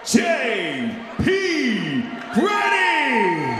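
long drawn-out fighter introduction; boxing ring announcer. Real, not acted. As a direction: stretch the name out big and loud.

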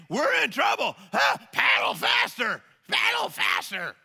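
high, loud shouted orders to paddle; hammed-up ship's captain shouting at the crew; panicked captain. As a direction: yell like a captain losing it in the storm. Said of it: "the ship's captain's going".

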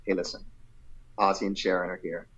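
low, hushed retold tip-off; imitating confidential aside. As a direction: let them in on it quietly. Mentor confides behind closed door.

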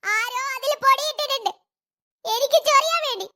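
put-on high kid voice, sulky and accusing; a hurt little girl blaming someone; child voice.

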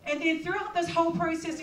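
ordinary delivery

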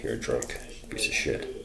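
whispered under the breath; retold jab, whispered low; whispered insult. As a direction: hiss the insult so he barely hears.

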